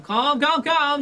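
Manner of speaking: high, loud chant of calm; calming elephant. Acted. GM voicing an elephant calming the crowd, high and loud. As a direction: croon calm like a huge soothing elephant. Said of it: "again, you know".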